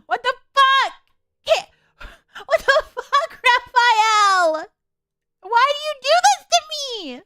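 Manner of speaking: high, loud, laughing exasperated outcry; overwhelmed outburst. Real, not acted. Player shouting at the game's love interest, loud and laughing. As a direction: burst out at the game, half laughing.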